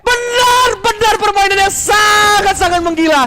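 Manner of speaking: fighting game announcer. Real, not acted. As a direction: scream the kill call. Casters call the kill.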